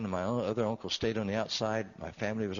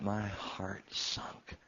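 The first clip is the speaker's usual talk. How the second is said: sinks to a near whisper, slow; hushed; 6 dB quieter, much slower than usual. Real, not acted. Telling the church how the news hit, hushed and slow. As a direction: let the words drop away, barely voiced.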